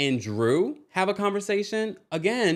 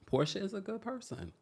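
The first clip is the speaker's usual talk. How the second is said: tags 1; 5 semitones higher, 12 dB quieter than usual. Shown as a demonstration. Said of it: "maybe if we say it enough".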